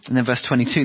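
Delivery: usual speaking voice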